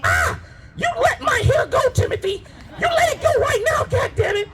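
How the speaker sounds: high mock shriek at the kid; frazzled mom shrieking. Mother shrieking at son pulling her hair, mock yell. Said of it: "we've all seen this lady".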